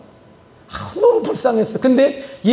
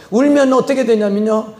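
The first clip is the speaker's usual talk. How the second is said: ordinary delivery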